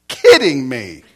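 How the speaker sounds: big incredulous outburst; loud disbelief. Retold or imitated. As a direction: burst out in mock outrage. His retold reaction as everybody laughs.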